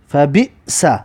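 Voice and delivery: "فا بی سا" put together, short break before "سا"; syllables joined up. Shown as a demonstration. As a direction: read the syllables back as one word. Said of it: "بنویسید به این طریق که". (خواندن هجاها به هم پیوسته)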